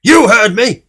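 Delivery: loud, high angry snap; snapped. Acted. Mister Grumpy snaps at Mister Happy, shouting angrily. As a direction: bite the words off. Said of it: "snapped Mister Grumpy".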